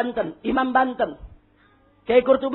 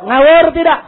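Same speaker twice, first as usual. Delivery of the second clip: louder repeated demand; rallying shout; 3 semitones higher, 8 dB louder than usual. Real, not acted. Preacher asks if the decree is nonsense, rallying shout. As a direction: push the crowd for an answer.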